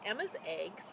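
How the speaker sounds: plain reading voice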